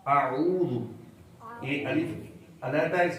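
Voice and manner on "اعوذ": اعوذ as "a-oo", الف separate and واو long; correct syllables. Shown as a demonstration. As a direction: split الف from عین in اعوذ and hold the oo. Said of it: "تلفظ کیا ہے؟". (اعوذ، الف الگ اور واو لمبا)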